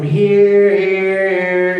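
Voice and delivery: walks the voice down one step at a time; stepping the pitch down. Shown as a demonstration. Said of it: "A semitone is like a half step in music".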